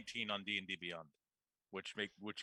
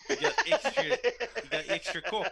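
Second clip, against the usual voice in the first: laughing; 12 semitones higher, 9 dB louder than usual. Real, not acted. Laughing teasing.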